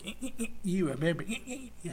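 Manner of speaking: high, quick, needling devil voice; taunting devil. Acted. Preacher mimics the devil reminding people of forgiven sins, mocking taunt. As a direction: pester like a nagging little devil. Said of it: "Now the devil's".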